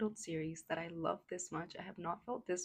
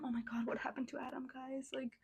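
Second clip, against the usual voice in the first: hushed aside; 6 semitones higher, 6 dB quieter than usual. Real, not acted.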